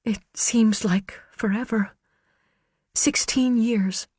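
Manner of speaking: wistful and fragile; Karen's voice. Acted. Witness Karen answering her husband's lawyer, high and fragile.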